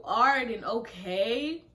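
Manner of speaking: loud, drawn-out, rising disbelief; slow, indignant retold echo; incredulous echo. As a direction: throw the words back as an outraged question. Throwing his replies back in disbelief.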